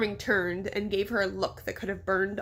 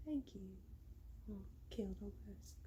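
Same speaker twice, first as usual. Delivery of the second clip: very soft British accent rehearsal; practicing British accent; 19 dB quieter than usual. Shown as a demonstration. Narrator warming up the mayor's voice, soft British accent. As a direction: murmur a trial British accent. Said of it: "Let me get the British accent again".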